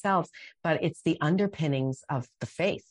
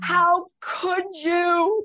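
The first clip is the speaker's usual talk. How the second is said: slow, high, loud wail at God; anguished sobbing cry; 14 semitones higher, 6 dB louder, much slower than usual. Retold or imitated. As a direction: cry it out to heaven, drawn out. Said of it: "walking through the house and just sobbing".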